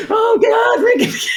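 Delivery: high, loud swooning cry. Retold or imitated. Imitating fans crying out over the song. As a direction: call out like a siren song. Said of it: "That is like a siren song".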